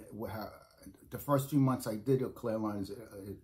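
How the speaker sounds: usual speaking voice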